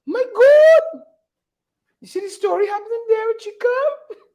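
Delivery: imitating a hollering man, high cry. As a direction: squeal it out an octave up. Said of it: "in an octave higher now".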